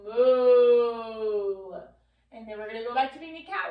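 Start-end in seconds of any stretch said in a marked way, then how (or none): 0.0-1.8 low, loud cow moo from the belly. Shown as a demonstration. Teacher leading kids in cow pose moo. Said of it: "take a big full breath and go".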